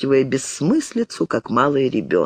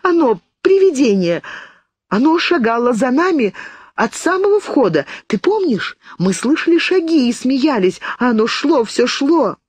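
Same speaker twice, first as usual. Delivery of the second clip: hysterical terror; 10 semitones higher than usual. A narrator's reading. Hysterical girl about ghost.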